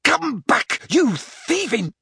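high-pitched furious yell; yelling curses. A narrator's reading. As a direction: yell it after a fleeing thief. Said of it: "swore at the top of his voice".

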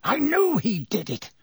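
high, rising accusation; teacher shrieking his accusation, mild. A narrator's reading. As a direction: insist on it wildly. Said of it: "shrieked Snape".

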